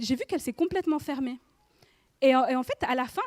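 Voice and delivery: ordinary talk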